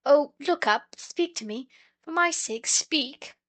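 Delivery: high, urgent pleading; earnest pleading. A narrator's reading. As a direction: beg him to wake up. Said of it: "as fast and as earnestly as they could".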